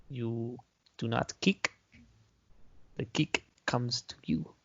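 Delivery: slow, quiet martial arts master; a hint of a stern kung fu teacher; Bruce Lee impression. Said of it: "this makes me into character".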